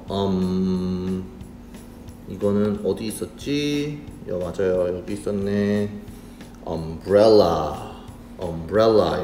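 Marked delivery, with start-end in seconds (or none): 0.1-1.2 "um" held long on a steady hum; sounding out. Shown as a demonstration. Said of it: "노란색 표시된 이 단어". 6.6-9.2 "umbrella" lower and drawn out, swelling on the middle syllable; pronunciation demo. Shown as a demonstration. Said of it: "이렇게 읽을 수가 있어요".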